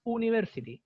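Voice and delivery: "university" starting with plain "oo"; pure U onset. Shown as a demonstration. Teacher showing the wrong reading, "university" with a pure oo. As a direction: say "university" as "ooniversity", no y sound. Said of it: "esa U no es U".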